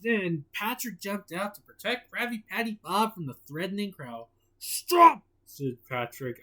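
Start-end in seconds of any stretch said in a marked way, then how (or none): 4.6-5.2 high loud sharp yell; shouting. A narrator's reading. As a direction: shout to halt the crowd. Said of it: "Patrick shouted".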